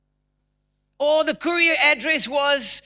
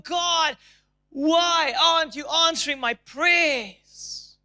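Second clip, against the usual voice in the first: desperate cry to God; 5 semitones higher, slower than usual. Retold or imitated. Preacher voicing an anxious person praying, anguished shout. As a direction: cry out to God like you're at the end of your rope.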